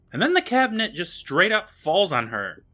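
ordinary talk

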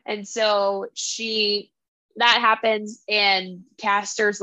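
normal talking voice

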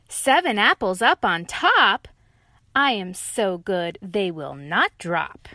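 loud proud boast; loud smug tiger; cocky tiger. Acted. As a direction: gloat over the pile.

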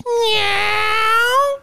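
very high long meow; cat meow. Retold or imitated. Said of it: "The cat goes".

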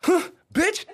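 mock taunt. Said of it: "I was like".

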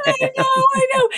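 high, squealing laugh-cry; crying with laughter. Real, not acted. Friend agrees through tears of laughter. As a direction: laugh so hard it turns to tears.

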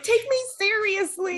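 very high, whiny mock protest; hammed-up, high-pitched indignant plea; mock tantrum. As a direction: squeal it like a small tantrum. Imitates own tearful tantrum.

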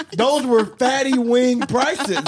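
high, slow, laughing joke. Real, not acted. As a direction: draw it out while laughing.

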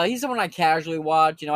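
usual speaking voice

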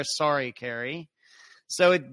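usual speaking voice

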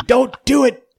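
high, loud mock scream of protest; yelling wife. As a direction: scream it like an alarmed wife, playfully overdone. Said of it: "yelling at you and screaming at you".

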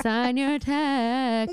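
slow, different voice. Retold or imitated. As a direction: say it slow in another voice. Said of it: "That was different".

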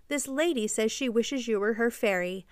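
usual speaking voice

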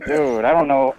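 normal talking voice

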